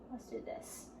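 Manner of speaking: murmured under the breath, unvoiced; quiet self-talk while working; whispered aside. Real, not acted. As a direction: whisper it to yourself. Aside while arranging shapes.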